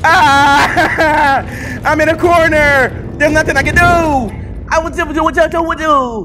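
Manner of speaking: high, loud, drawn-out helplessness; cornered wail. Real, not acted. Player trapped in a corner, helpless wail.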